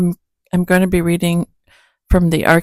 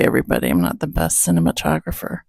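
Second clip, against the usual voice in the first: usual speaking voice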